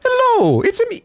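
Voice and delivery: very high squeaky Mario voice. Retold or imitated. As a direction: pipe it up like Mario.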